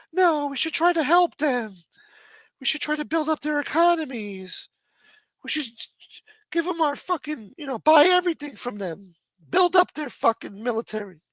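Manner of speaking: high, mocking do-gooder voice; mocking liberal voice. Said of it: "A lot of good that did".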